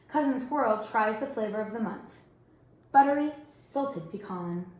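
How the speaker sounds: plain reading voice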